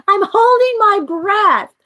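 high, slow, loud and laughing; hammed-up repeat of her own defence, cracking up; laughing mock retort.

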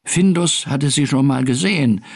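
usual speaking voice

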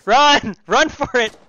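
loud high yell; shout. Real, not acted. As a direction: scream at them to run. Urging teammates.

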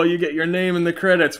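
ordinary delivery

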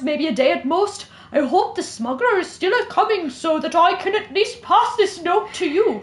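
careful attempt at British, leaning Irish; British-Irish accent. Shown as a demonstration. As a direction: read it in British drifting towards Irish. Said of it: "it's a little bit Irish".

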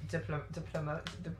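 stumbling on "diploma-", breaking off; halting false starts on "diploma-, di-"; failed pronunciation. Acted. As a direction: try "diplom-" repeatedly without finishing it. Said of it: "he can't pronounce it".

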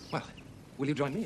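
usual speaking voice

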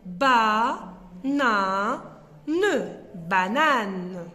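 ba, na, ne slowly and loud, then banane whole; slow sounding out of banane; slow syllables then word. Shown as a demonstration. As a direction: stretch each syllable then blend. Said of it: "Voilà le mot".

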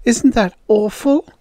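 acted self-pity; pathetic. A narrator's reading. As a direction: seek sympathy from the others, dismayed. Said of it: "looked up at the others pathetically".

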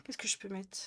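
soft unvoiced whisper. Real, not acted. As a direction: whisper it to yourself. Musing to herself mid-stream.

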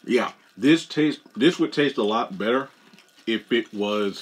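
chewing between words; muffled through food; mouth full of cookie. Real, not acted. Seen 1: Bites cookie at -5; holds bitten cookie, lips tight while speaking.

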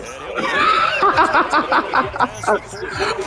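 long high-pitched giggling fit; big laugh. Real, not acted. Gamer cracking up mid-game, long high laugh. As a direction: crack up and keep going.

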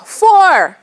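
high, loud golf warning call; shouted fore. Shown as a demonstration. Teacher acts out a golfer's warning, high loud shout. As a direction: call it out across the course. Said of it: "he shouted".

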